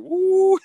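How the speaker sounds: loud, high-pitched whoop; high "woo". Retold or imitated. Joking about parents' reaction to swearing, high whooping woo. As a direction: let out a big high woo.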